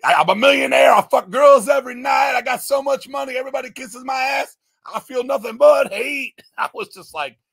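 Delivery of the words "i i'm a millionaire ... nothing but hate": mock angry lyrics. Mocking the album's lyrics.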